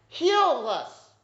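high, loud desperate plea; calling out. Acted. Lepers crying out to Jesus for healing, loud plea. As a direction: cry out to Jesus from afar. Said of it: "They're calling out".

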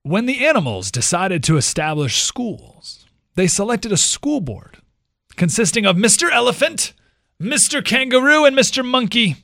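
loud, high storyteller voice rising; loud high storytelling build; storybook reading building up. A narrator's reading. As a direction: read it like a children's fable, building. Reading fable aloud, building.

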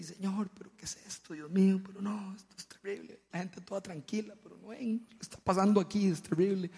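mock-panicked airplane prayer; nervous plea. Nervous flyer pleading with God mid-flight, anxious. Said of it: "yo estaba nervioso".

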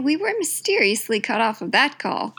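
usual speaking voice

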